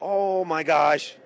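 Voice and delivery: slow, hushed oh my gosh; awed. Real, not acted. Host first sees the finished car, slow and awed. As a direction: let it sink in, slowly amazed.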